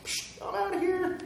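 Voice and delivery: high, dismissive mock voice, scoffing Psh; a prophet blowing off his calling, mock scoff; mock Jonah brushing it off. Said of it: "He was like".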